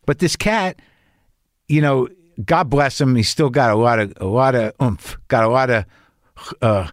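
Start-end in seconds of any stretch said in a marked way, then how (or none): none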